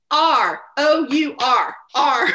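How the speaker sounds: loud drawn-out Southern "R, O U R, R" as "our"; Southern spelling. Retold or imitated. Teacher mimics her class spelling R in Southern drawl. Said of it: "no lie, they go".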